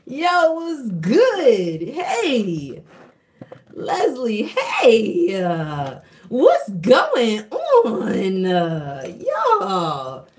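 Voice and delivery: high, loud, drawn-out hello; excited greeting. Real, not acted. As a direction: greet a friend with delight.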